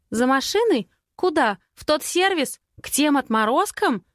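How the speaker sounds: high, incredulous volley of questions; drumroll of questions. A narrator's reading. Friend balks at going to the garage, high-pitched volley. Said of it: "летит в ухо барабанной дробью".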